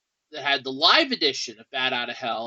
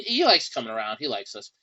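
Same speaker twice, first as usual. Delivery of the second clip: ordinary delivery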